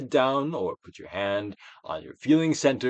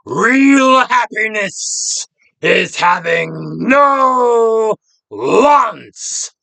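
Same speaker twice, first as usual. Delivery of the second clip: slow, much higher and louder, crying out the quote; quoting Socrates; 11 semitones higher, 14 dB louder, much slower than usual. Retold or imitated. Teacher quoting Socrates to the class, slow and crying out. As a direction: cry the words out slowly, like a revelation. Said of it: "Socrates said".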